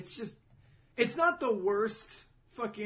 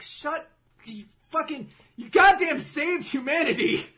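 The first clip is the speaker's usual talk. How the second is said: loud, high mock outrage; exasperated outburst; 10 semitones higher, 11 dB louder, slower than usual. Reader scolding a hero who saved humanity, mock-exasperated. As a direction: yell it in acted disbelief. Acted exasperated retort to the reactions.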